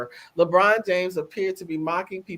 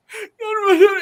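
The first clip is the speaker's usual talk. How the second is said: high mock sob; high, loud fake sob; demo fake cry; 9 dB louder than usual. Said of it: "then she showed me".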